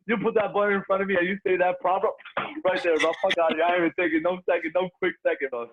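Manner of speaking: loud, high and fired up; all-in hype, shouting it out; hyped up. Real, not acted. Hyped over billion-dollar button hypothetical.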